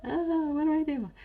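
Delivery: high-pitched, hammed-up cluelessness; mock helpless student. Student lost on how things were pronounced, mock helpless and high. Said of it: "so I'm like".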